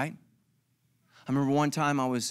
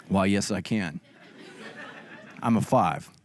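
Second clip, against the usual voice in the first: much lower, lightly voiced self-satisfied nerd; smug Five; 10 semitones lower than usual. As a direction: answer with mock dignity. Five answering the admiring ladies.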